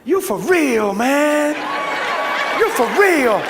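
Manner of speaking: loud, high Southern amazement; big, punched Southern exclamation; amazed Southern man. Retold or imitated. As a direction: imitate a man astonished and impressed. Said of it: "Watch what he said".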